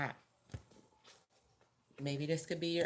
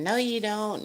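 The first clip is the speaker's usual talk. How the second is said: sharp snapping no, higher and louder; mock aggressive retort; 5 semitones higher, 8 dB louder than usual. Reader shows the wrong way to argue, with a mock snappy retort. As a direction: push back like an argument.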